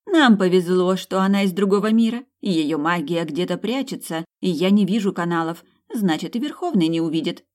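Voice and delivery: usual speaking voice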